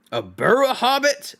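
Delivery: startled trolls. Acted. Trolls puzzle over the word hobbit, high and startled. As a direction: repeat it back baffled like startled trolls. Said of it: "a bit startled".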